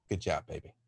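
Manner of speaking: put-on low, soft "Good job, baby"; tender mother. Mother kissing her child goodbye, low and tender. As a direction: murmur it lovingly as she kisses her head. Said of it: "She's like".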